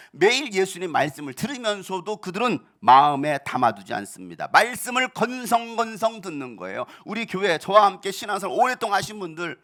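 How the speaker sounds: loud, rising fervent sermon rebuke; full-force preaching. Acted. Pastor rebukes disciples and today's church leaders, loud fervent preaching. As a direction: thunder it at the congregation.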